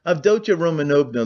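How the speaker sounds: a touch of huffiness; huffy. A narrator's reading. Offended fiancé addresses Dounia, mildly huffy. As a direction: say her name with mild offence. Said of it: "Luzhin declared huffily".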